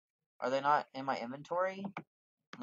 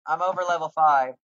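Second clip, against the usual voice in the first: louder, higher exasperated protest; annoyed that the quest is locked, louder; indignant; 5 semitones higher, 12 dB louder than usual. Real, not acted. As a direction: complain at the game.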